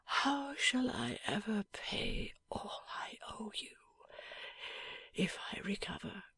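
faint, slow breathless whisper. A narrator's reading. Wounded husband asking his wife, faintly whispered. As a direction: barely breathe it out. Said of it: "he said faintly".

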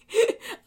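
sudden high laugh breaking out; burst of laughter. Real, not acted. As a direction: laugh it off after the interruption. Laughs after phone interruption.

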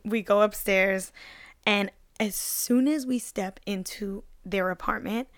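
ordinary talk

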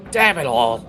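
loud, high exasperated outburst; annoyed curse. Acted. Heather cursing after spraying mead at the joke, loud and annoyed. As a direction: spit it out in disgust.

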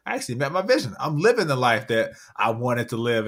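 sudden high, loud burst of wonder; amazed realization. Retold or imitated. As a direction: gasp it out as if you just noticed you made it. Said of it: "and I'm like".

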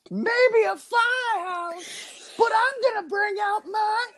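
[name] rock-star voice. Acted. Podcast host playing [name] for the crowd, hammed-up arena shout. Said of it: "Voice of [name]".

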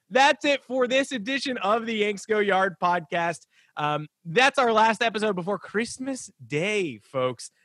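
upbeat slow outro to the folks; podcast sign-off. Acted. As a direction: wrap up the show for the listeners.

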